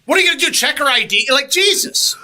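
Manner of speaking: high, loud exasperated rant; fed up, hurry the cashier along. Retold or imitated. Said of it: "And it's like".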